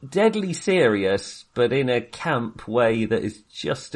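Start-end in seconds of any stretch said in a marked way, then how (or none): none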